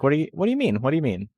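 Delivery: acting out baffled denial; mock defensive. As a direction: play innocent, taken aback. Retold defensive reply to wife.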